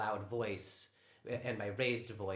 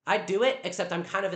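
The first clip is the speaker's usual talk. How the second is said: raised voice; 6 semitones higher, 8 dB louder, faster than usual. Real, not acted. Snapping back at housemate.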